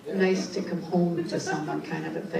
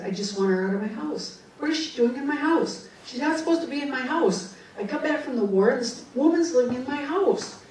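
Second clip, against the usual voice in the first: higher-pitched mock-indignant protest; indignant complaint; 7 semitones higher, faster than usual. Ghost complains about the woman in his house, indignant. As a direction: imitate an aggrieved ghost's fast, rising complaint.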